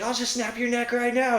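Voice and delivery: high, cocky threat; mock threat. Speaker threatening the old man, cocky and pitched high. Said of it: "I was like".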